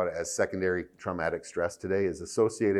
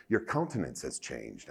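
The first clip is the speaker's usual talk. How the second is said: lower, softer, a touch of his wife's formal way of talking; imitating his wife; 6 semitones lower, 7 dB quieter than usual. Husband quoting his wife's formal phrase, lightly imitated. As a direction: quote her stately phrasing, lightly put on. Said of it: "And she talks like this".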